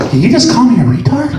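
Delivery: indignant, pitch climbing high; acted-out incredulous rant; triggered outburst. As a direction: build from disbelief to high-pitched outrage. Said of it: "Now, you wonder why I get triggered".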